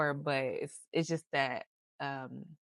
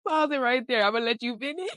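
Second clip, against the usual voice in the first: high, laughing "pause it"; cracking up as she stops her sister mid-thought; laughing interruption; 10 semitones higher, 5 dB louder than usual. Real, not acted. Sister laughing, cutting in on her tangent, giggly and high.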